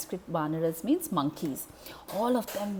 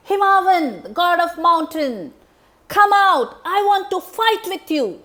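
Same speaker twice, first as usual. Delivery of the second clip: loud, high bellowing call; shouting challenge; 10 semitones higher, 13 dB louder than usual. Acted. Demon Dundubi shouting at the mountain god, loud challenge. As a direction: yell it to call him out. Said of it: "Why are you shouting?".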